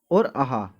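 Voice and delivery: आहा with a breathy h ending. Shown as a demonstration. Teacher voicing the visarga sound for students. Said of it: "कौन कौन सी?".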